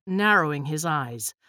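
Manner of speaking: usual speaking voice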